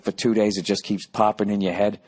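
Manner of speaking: usual speaking voice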